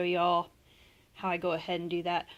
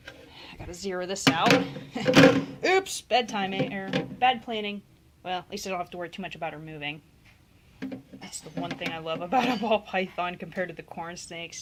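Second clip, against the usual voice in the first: ordinary talk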